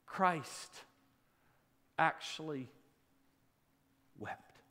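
soft, low, slow reverent hush; a tender hushed reveal; hushed awe. Acted. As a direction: let it fall to almost nothing.

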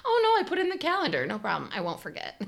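quick, high, airy no problem; breezy fake reassurance. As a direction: rush out an easy promise. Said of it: "And I was like".

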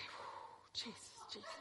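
breathless whispered relief; a worn-out whispered Jesus; whispered exhale. Retold or imitated. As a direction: whisper it out, spent. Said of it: "I said".

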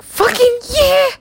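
very high, slow, loud whoop; excited cheer. Retold or imitated. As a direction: cheer it out like thrilled parents. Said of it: "They're like".

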